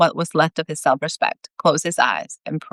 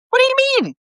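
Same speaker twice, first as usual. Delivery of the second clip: very high, startled outburst; shocked question; 4 dB louder, much faster than usual. A narrator's reading. Brad learns he is not scheduled to die, shocked high question. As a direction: blurt it out in high-pitched disbelief.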